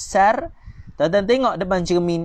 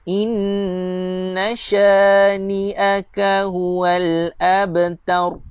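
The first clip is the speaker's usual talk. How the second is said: very slow, drawn-out chant; measured tajwid recitation; Quran recitation; 2 semitones higher, much slower than usual. Shown as a demonstration. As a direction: recite it slowly, stretching each vowel. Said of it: "Sekali lagi saya baca".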